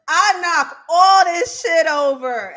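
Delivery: loud, high, drawn-out retelling; emphatic mock outburst. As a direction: hammer out the quote, relishing each word. Repeats Farrah's outburst for effect.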